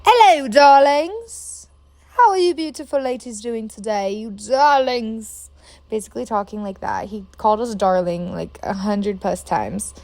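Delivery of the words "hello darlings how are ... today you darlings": campy flamboyant stranger; campy drag queen. Retold or imitated. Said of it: "he talks like this".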